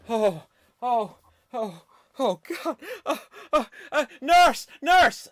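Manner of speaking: high wailing sick man; Joey with leprosy. Acted. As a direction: wail in pain, calling for the nurse. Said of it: "Joey Tribbiani suffering from leprosy".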